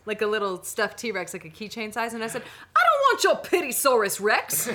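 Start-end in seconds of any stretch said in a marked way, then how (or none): 2.8-4.7 high, loud comic outburst; mock indignation. Slighted fan handed a keychain toy, loudly indignant. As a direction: refuse it like an insulted fan.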